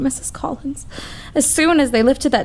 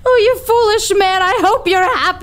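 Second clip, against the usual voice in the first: tearful reproach; 8 semitones higher than usual. Acted. Casey, fired, breaks down.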